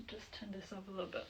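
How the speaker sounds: very soft, quick aside; quiet aside. Real, not acted. Teacher adjusting the sound mid-lesson, quiet aside.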